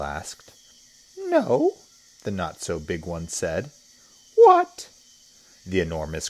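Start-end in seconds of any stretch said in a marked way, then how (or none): 1.2-1.8 much higher, louder squeaky little crocodile voice; small crocodile's high-pitched refusal; not-so-big crocodile. Acted. Not-so-big crocodile answering the enormous one, very high voice. 4.4-4.9 very high, loud little croc voice; not-so-big crocodile. Acted. Not-so-big crocodile asking about lunch, high loud pipe.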